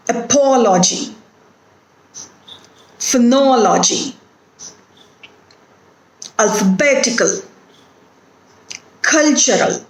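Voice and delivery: word list stressing the third syllable from the end, "Ability" as a-BI-li-ty; third-from-last stress. Shown as a demonstration. Teacher giving examples of a rule, third-from-last syllable stressed. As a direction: hit the antepenultimate syllable, "Photography" as pho-TOG-ra-phy. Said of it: "the third syllable from last".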